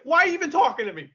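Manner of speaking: high, fast, indignant imitation; indignant retort. Referee answering the commentator who yelled at him, imitated indignant retort. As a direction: act out an affronted referee.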